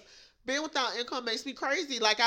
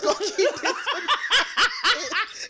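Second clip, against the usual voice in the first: laughing; 7 semitones higher, 4 dB louder than usual. Real, not acted. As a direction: laugh your way through it. Laughing at own hoarding.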